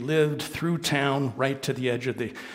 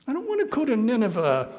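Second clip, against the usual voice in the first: whiny Jonah; 8 semitones higher than usual. Acted. Jonah whining to God.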